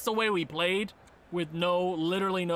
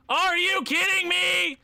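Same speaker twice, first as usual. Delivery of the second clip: higher, louder, slow disbelief; can't believe these guys won; outraged shout; 12 semitones higher, 6 dB louder, slower than usual. Real, not acted. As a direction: protest loudly at the screen. Outraged at the Trophy winners.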